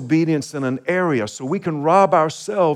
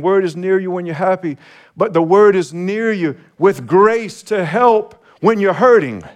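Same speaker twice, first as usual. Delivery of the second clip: rising to a louder preaching climax; building preaching; 5 semitones higher, 4 dB louder than usual. Acted. Pastor urging the congregation that the word is near, building preaching.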